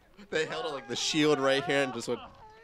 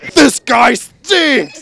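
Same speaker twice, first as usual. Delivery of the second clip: loud drawn-out heckle; heckling shout; 12 semitones higher, 17 dB louder, much slower than usual. Pretend. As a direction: yell it slowly at the stage. Heckling the storyteller.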